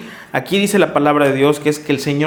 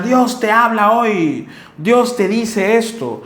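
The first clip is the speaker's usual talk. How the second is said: hammed-up prophecy, much higher and very slow; mock prophet; 6 semitones higher, much slower than usual. Preacher parodying Sunday prophets, slow high mock-prophetic voice. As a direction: parody a showman prophet, dragging every word out. Mocking prophet announcing messages.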